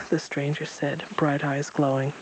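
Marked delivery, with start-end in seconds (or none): none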